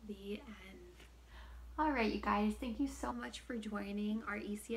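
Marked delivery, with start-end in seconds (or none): 0.1-1.0 whispering. Acted. Whispered story ending.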